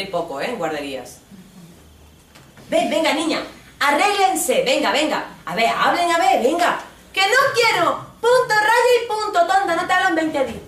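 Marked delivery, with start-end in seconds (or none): 2.7-6.8 high, loud scolding teacher; bossy nursery teacher. Retold or imitated. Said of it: "le llega la profesora". 7.1-10.6 very high, loud tantrum voice; stroppy little girl. Retold or imitated. Niña enfurruñada en guardería.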